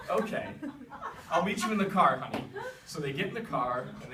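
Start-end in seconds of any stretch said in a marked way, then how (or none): 0.1-2.4 laughing through it. Acted. Mom's indulgent reply.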